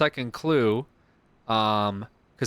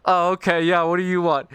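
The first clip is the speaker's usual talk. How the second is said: mock annoyed cop; 6 semitones higher, 5 dB louder than usual. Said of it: "Earl's like".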